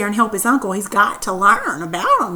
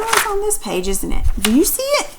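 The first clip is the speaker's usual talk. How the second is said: usual speaking voice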